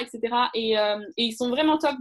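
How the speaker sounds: ordinary talk, nothing marked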